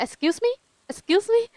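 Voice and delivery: high, rising, repeated question; insistent mock demand. Imitating the lady who kept saying it, insistent. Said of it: "She kept saying that".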